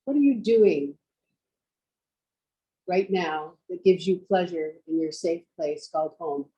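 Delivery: measured yoga-teacher voice with long pauses; guided meditation. Real, not acted. Teacher guiding students to picture home, meditative delivery. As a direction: guide them inward, leaving space after each phrase.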